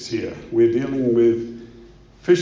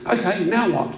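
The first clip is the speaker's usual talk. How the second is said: raised, higher-pitched caller voice; hunter on phone; 7 semitones higher, 4 dB louder than usual. Acted. Hunter back on the line to the operator, voice raised. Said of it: "He's panicking".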